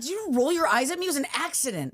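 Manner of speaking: high, indignant challenge; indignant confrontation. Retold or imitated. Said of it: "And I was like".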